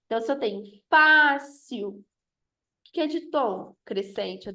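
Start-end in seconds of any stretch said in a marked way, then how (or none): 0.9-2.0 "fácil" drawn out, with FÁ strong; stress on FÁ. Shown as a demonstration. Teacher giving an accented example, FÁ stressed in fácil. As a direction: stretch fácil, punching the first syllable FÁ. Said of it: "Acentua-se as palavras paroxítonas".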